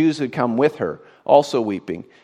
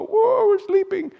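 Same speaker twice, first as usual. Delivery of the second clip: groggy sleepers waking. Acted. Raised dead waking up.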